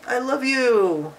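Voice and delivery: high, loud, drawn-out declaration; happy exclamation. Shown as a demonstration. Happy example of exclaiming for a child, exclaimed. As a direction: exclaim it happily, stretched out slow. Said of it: "it could be a happy thing".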